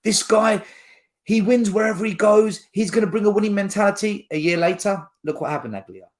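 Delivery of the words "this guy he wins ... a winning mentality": higher-pitched, hyped-up imitation of fans; excited fans. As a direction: mimic fans gushing over a new signing. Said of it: "we got excited".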